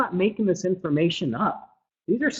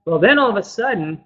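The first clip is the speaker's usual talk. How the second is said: ordinary delivery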